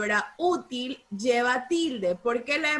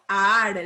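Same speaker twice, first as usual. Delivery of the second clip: "Ar" louder and lower, A joined to R; stressed syllable demo; 5 semitones lower, 5 dB louder than usual. Shown as a demonstration. Teacher showing A with R, firm and emphatic. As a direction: sound "Ar" out firmly as one syllable. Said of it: "la A con la R".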